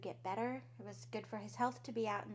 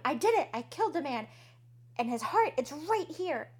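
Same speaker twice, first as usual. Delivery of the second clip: louder, higher frantic confession shout; confessing scream; 5 semitones higher, 10 dB louder than usual. Acted. Murderer screams his confession at the police. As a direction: blurt it out like the guilt finally broke you. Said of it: "I just jump up and scream".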